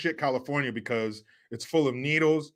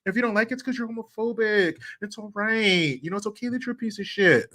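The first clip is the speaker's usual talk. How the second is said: higher, mock-sweet backhanded voice; sugary mock compliment, higher pitched; mocking Dylan Mulvaney; 6 semitones higher than usual. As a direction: imitate a fake-kind put-down in a higher voice. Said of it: "even says it like this".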